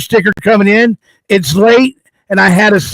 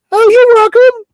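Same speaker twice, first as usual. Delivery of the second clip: much higher playful put-on voice; high sassy voice; 14 semitones higher than usual. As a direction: answer in a sassy falsetto. Answers himself as Miss Fabulous.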